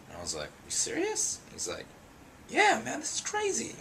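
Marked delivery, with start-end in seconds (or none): 0.6-1.4 much higher, squeaky disbelief; a mock high-pitched double take; surprised. [name] doubting Kev's idea, squeaky mock surprise. Can't believe producer's offer. 2.5-3.7 much higher, hyped mock voice; imitating a hyped-up friend; excited. Kev assuring [name], hyped high-pitched imitation. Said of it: "he's bugging out again".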